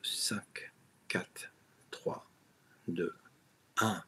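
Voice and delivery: whispered count on a held breath; holding breath. Real, not acted. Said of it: "Je bloque la respiration".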